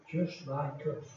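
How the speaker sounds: usual speaking voice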